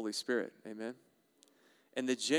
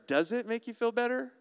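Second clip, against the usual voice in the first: high, rising doubtful question; sceptical questioning; 9 semitones higher than usual. Acted. Preacher doubting that venting helps, sceptical questioning. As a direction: climb in pitch to the end, unconvinced. Sceptical echo of imagined answer.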